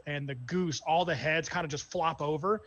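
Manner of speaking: ordinary delivery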